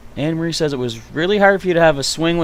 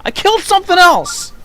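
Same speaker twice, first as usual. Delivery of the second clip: shocked outburst; 6 dB louder than usual. Real, not acted. Accidentally kills animal in grass.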